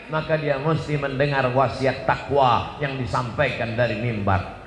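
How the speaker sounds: ordinary talk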